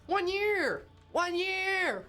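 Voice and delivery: high, punchy celebratory exclamation; Adam impression. Streamer celebrating a subscriber's twelve months, Adam impression. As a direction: call it out high and drawn-out in Adam's voice. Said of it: "In Adam's voice. That's my Adam impression".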